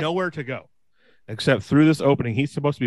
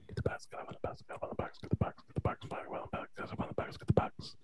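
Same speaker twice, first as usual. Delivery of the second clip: breathy unvoiced whisper right into the mic; ASMR whisper; 17 dB quieter, faster than usual. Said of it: "Let's do an A S M R".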